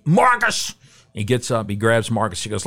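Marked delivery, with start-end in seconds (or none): 0.0-0.8 high-pitched mock holler of the name; imitated angry call. Coach summoning his player in the locker room, imitated holler.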